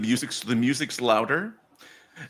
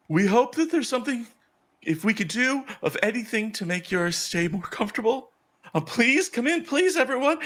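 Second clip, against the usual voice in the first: very high, chirpy greeter voice; high-pitched, over-keen hostess; excited priestess; 11 semitones higher than usual. Acted. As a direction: play an eager priestess welcoming guests. Priestess ushers guests in.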